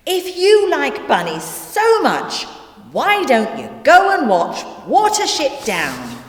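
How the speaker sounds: high-pitched, exasperated retort; exasperated suggestion. Acted. Narrator to bunny-loving Nelson, exasperated.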